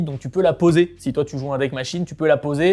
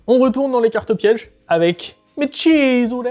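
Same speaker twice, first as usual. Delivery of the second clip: slow, hammed-up Italian accent, pitched up and loud; 9 semitones higher, 8 dB louder, slower than usual. Announcing a trap card, in a put-on Italian accent. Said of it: "j'ai fait un accent italien".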